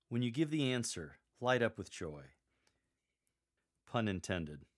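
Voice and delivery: normal talking voice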